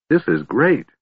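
high, delighted exclamation; excited delight. A narrator's reading. Kender trying out the new lance, excited. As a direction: pitch it up with eager delight.

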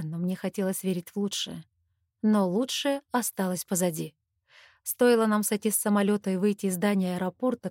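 plain reading voice

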